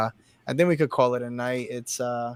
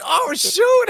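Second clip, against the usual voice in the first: high startled "oh, shoot"; startled squeal; 8 dB louder than usual. Retold or imitated. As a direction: yelp it out, caught off guard. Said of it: "and I was like".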